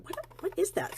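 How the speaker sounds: high, bewildered little what-is-that; puzzled inner question. Retold or imitated. Alone at her desk, she hears a strange sound: bewildered. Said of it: "I thought".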